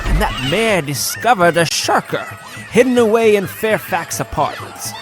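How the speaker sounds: plain reading voice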